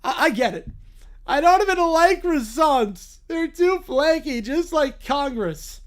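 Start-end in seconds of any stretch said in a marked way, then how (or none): 1.3-5.8 high, slow, whining cartoon bear; whiny cartoon bear. The bear complains about croissants.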